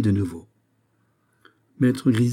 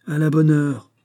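louder, higher exclamation; emphatic approval; 7 semitones higher, 6 dB louder than usual. Acted. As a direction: welcome the frank answer out loud. Malade approuve la réponse franche. (approbation lancée d'une voix forte)

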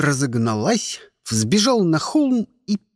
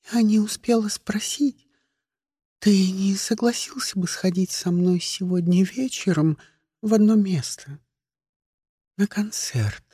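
hushed, secretive invitation; whisper; 3 semitones higher than usual. A narrator's reading. Egg girl privately inviting Kostya to a concert, whispering. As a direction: ask it in a light whisper. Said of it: "шёпотом спросила подкатившаяся ко мне яйцерина".

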